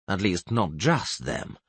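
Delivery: plain reading voice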